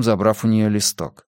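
ordinary delivery, nothing marked

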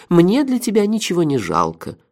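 high cooing, gliding down; mock-doting generosity to a friend; tender concern. A narrator's reading. Melifaro spares nothing for his friend, tender concern. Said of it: "с нежной заботой в голосе".

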